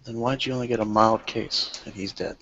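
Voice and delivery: ordinary talk, nothing marked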